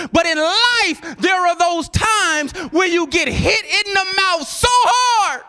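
high preacher's cry building to a shout; slow down and build to a shout on the last word; rising sermon climax; full-force preaching. Real, not acted.

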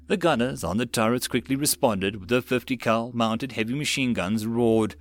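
ordinary delivery, nothing marked

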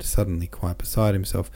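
plain reading voice

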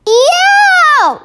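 loud, very high drawn-out howl; giant's roar. A narrator's reading. Sleeping giant cries out in a nightmare, roaring. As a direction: roar it out at the top of the voice. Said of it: "roared the flesh lump eater".

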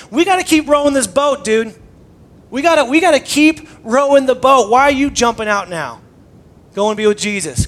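loud, pushy calls over the storm; urgent shouting disciples. Retold or imitated. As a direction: yell it like tired rowers in a gale. Disciples shouting while rowing boat.

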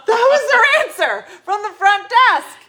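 high, loud outburst through laughter; laughing disbelief. Real, not acted. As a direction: exclaim it incredulously while laughing.

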